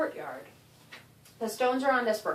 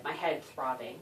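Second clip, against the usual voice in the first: ordinary delivery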